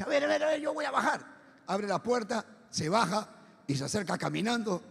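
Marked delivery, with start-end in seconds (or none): 0.0-1.4 imitación aguda de un peruano decidido; peruano criollo fanfarrón. Retold or imitated. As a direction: haz de criollo envalentonado, voz subida. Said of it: "el peruano criollo, dice". (peruano criollo, agudo y resuelto)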